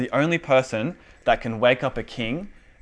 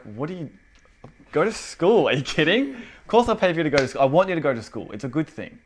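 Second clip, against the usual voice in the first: higher, animated disbelief, acted; incredulous dad; 5 semitones higher than usual. Baffled dad answering his child about school, mock incredulous. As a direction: react as if the request is absurd. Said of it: "He'd be like".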